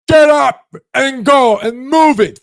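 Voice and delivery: high, loud barking order; harsh nagging voice. Pretend. Tough inner voice ordering you out of bed, loud and high. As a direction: yell it like a drill sergeant. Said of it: "it says".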